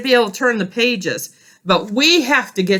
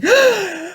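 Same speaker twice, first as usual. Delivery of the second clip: gasp; 11 semitones higher than usual. Acted. Grover sees page turned.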